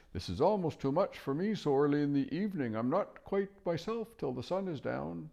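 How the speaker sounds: high, drowsy owl voice; sleepy owl. Acted. Owl complaining it is too early in the evening.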